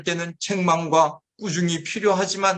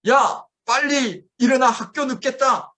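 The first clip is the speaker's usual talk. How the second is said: yelling parent; 8 semitones higher than usual. Retold or imitated. Parent yelling at a sleeping child, a mock yell. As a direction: bark the child out of bed. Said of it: "큰 소리를 치면서".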